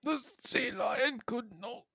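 nature documentary narrator. Retold or imitated. Continues the Attenborough parody.

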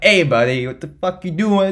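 imitating someone rude getting in your face; mock aggressive confrontation. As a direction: bark it like a rude stranger. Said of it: "you're like".

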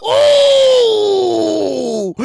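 loud falling ooh; long hooting reaction to pettiness; drawn-out whoop. Acted.

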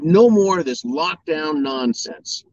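a hammed-up DeSantis banning lockdowns; defiant governor. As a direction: spit it out like a fed-up governor laying down the law.

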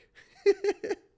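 sudden loud high laugh; high burst of laughter. Real, not acted. Laughs at own excuse.

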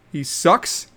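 normal talking voice

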